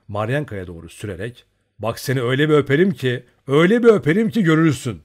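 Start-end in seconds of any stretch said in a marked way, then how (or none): 1.8-3.2 playful threat. A narrator's reading. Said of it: "genç kıza doğru eğilerek". 3.5-5.0 faster, much louder, higher repeat; building playful threat. A narrator's reading. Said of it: "genç kıza doğru eğilerek".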